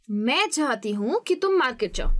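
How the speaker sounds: तुम said strongly, the rest even; stress on तुम. Shown as a demonstration. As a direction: lean on तुम. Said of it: "Hindi में पढ़ के सुनाती हूँ". (तुम पर ज़ोर)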